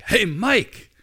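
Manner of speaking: high, loud hail across a stadium; shouted call. Retold or imitated. Voice calling across the stadium a second time, shouting. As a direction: holler it to someone far off in the stands. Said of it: "the voice shouted back".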